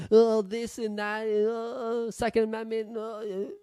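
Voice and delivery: high, slow mocking whine; mocking critics. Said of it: "they trash on it".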